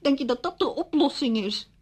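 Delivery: loud, high-pitched incredulous retort; indignant disbelief. Acted. Widow rejecting curtains as a solution, indignant. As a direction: throw the suggestion back, loud and high. Scorns suggestion of cheerful curtains.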